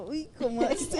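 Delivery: mock astonished, laughing; laughing surprise. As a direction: act your past shock with a laugh. Imita su propio asombro riendo. (sorpresa fingida entre risas)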